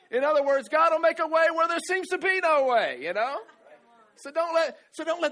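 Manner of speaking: loud, high proclamation; full-force preaching. Acted. Pastor proclaiming to the congregation, loud and high. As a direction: shout it out to the church.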